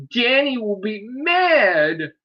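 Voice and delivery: high, slow, loud taunting voice; mock the timid warning; mocking whiny voice.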